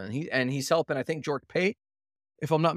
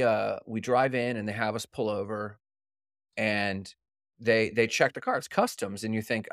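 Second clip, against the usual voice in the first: ordinary talk, nothing marked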